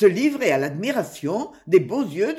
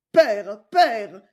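mildly raised, louder cry; 5 dB louder than usual. A narrator's reading. Robin calling his father by the burnt house, crying out. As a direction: call out twice, with moderate force. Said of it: "cria Robin".

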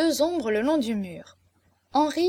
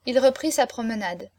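usual speaking voice

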